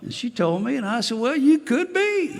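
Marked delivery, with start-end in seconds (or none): 1.2-2.3 high, louder dawning surprise; stunned realization. Retold or imitated. Retelling his reply to the woman who may be his daughter, surprised. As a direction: let it hit him that it's possible.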